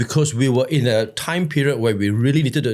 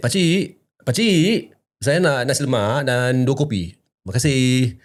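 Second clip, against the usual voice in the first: Malay accent. Shown as a demonstration. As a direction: put on a Malay accent to order. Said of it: "with the accent".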